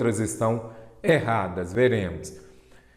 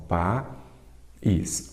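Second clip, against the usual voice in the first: país slowly split as "pa... ís"; sílaba por sílaba; 2 semitones lower, 4 dB quieter than usual. Shown as a demonstration. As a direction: break país into two slow syllables, pa and ís. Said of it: "Vamos separar país". (país separado devagar em sílabas)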